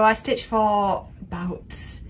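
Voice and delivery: ordinary talk, nothing marked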